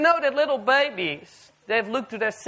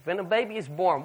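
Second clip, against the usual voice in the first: ordinary talk, nothing marked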